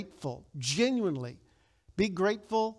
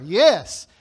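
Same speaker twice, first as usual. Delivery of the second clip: ordinary talk, nothing marked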